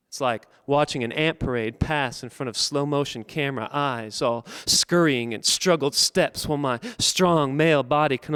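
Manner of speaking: deliberate phrases with pauses, swelling in intensity; spoken-word poem. Acted. Poet reads his piece for George's funeral, measured building recitation. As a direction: recite rhythmically, drawing out key words.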